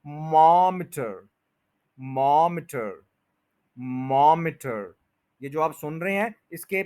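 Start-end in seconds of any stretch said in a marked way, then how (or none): 0.1-4.9 मॉमीटर with long stressed MAAM, short "iter"; practice drill. Shown as a demonstration. Teacher drills the easier tail for learners, slow stress. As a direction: stretch and hit the start of मॉमीटर. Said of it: "इसको बस बोलें एंड तक".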